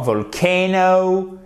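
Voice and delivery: VOLCANO slow, leaning on a long CAY; second-syllable stress. Shown as a demonstration. Teacher shows the tonic accent to students, emphatic second syllable. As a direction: stretch the KAY in VOLCANO. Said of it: "sur la deuxième syllabe".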